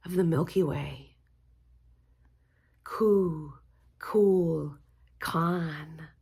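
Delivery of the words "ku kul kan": Ku, Kul, Kan spoken as three separate syllables. Shown as a demonstration. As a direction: break Kukulkan into Ku, Kul, Kan. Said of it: "So that's the second mantra".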